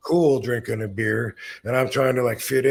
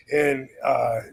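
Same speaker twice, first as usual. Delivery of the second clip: normal talking voice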